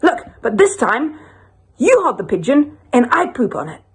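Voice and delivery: high, eager character voice; statue man. Acted. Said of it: "the statued man says".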